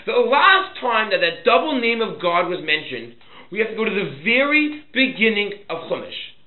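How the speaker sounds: loud, high teaching voice driving the point; emphatic preaching. Real, not acted. Preaches at full force.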